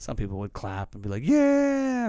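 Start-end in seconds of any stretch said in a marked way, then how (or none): 1.2-2.1 much higher, hammed-up whoop; mock fan cheer. Mocking drive-in fans cheering, hammed-up whoop.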